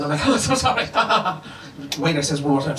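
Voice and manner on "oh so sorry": high-pitched flustered apology breaking into babble; floundering. Retold or imitated. Embarrassed boyfriend unable to answer waiter, acted floundering. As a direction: flounder helplessly through a mock apology. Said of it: "I was just floundering".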